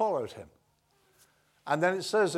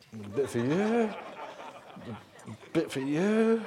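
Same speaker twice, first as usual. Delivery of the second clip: slow, drawn-out, unsure handout; tentative offering; 3 dB louder, slower than usual. Acted. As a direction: offer it warily, testing whether it works. Peter hesitantly hands out food.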